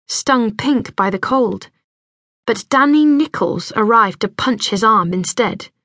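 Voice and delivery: plain reading voice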